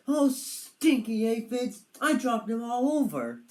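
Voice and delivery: high, loud dismayed cry; oops, dropped everything; dismayed dragon. Acted. Padraig dismayed.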